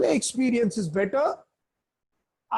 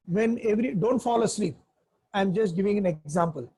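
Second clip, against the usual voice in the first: ordinary delivery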